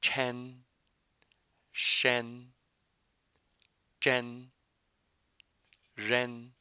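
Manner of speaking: curled-tongue series chen shen jen ren; Chinese retroflexes. Shown as a demonstration. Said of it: "I'll say each of these one more time".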